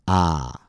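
"ah" met de tong naar achteren, kaak omlaag; "ah" open en achterin; ah vowel. Shown as a demonstration. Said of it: "It sounds like this".

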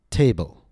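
long vowel in the TA of "Table", pitch falling after it; stressed first syllable. Shown as a demonstration. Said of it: "Notice the long vowel and the raised pitch".

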